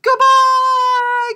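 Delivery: big high shouted "Goodbye!"; loud goodbye. Shown as a demonstration. Librarian shouts the goodbye as loud as she can. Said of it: "I'm gonna say it loud".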